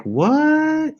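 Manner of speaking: shocked what. Retold or imitated. Reacting to seventeen percent French, in mock shock. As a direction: act stunned by the DNA result. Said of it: "And I was like".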